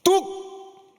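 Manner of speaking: high, abrupt mock-mother command; 엄마의 호령. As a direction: cut off a child's crying with one word. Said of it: "엄마가 와서 뭐라고 이야기합니까". (엄마처럼 높고 짧게 호령하듯)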